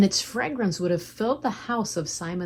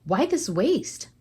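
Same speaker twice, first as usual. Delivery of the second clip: slow, raised protest, mildly indignant; 5 semitones higher, 5 dB louder, slower than usual. A narrator's reading. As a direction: voice the disciples' indignation, higher and louder. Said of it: "they were indignant".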